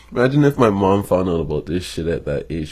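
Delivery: usual speaking voice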